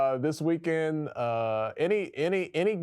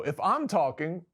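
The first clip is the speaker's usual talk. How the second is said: insistent order; 7 semitones higher than usual. Retold or imitated. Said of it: "I told her before the show".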